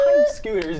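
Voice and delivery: loud, high excited yell; excited shout. Real, not acted. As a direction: shout it like great news. Excited shouted repeat of headline.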